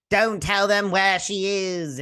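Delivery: high, slow character impression; Willow. Willow begging her not to tell, high impression. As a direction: draw it out in a high, put-on voice. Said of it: "I do a good Willow impression".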